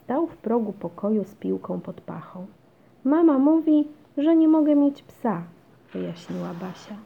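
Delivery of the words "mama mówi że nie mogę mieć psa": high, loud grievance; complaining child. A narrator's reading. Basia tells her brother what mum said, complaining. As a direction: protest to a sibling, high and loud.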